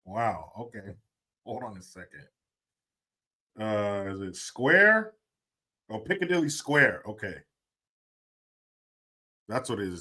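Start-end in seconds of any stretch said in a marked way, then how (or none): none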